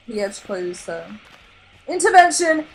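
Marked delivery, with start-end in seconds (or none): 1.9-2.7 high, loud call; loud, high group yell. A narrator's reading. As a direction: shout to stop him. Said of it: "yelled Anchor and Chum".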